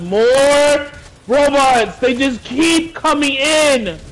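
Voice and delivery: panicking gamer. Real, not acted. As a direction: shout it as the robots keep coming. Robots keep dropping in.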